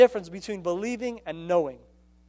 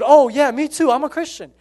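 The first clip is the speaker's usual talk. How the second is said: eager retold claim; 6 semitones higher, 7 dB louder than usual.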